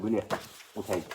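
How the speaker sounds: ordinary talk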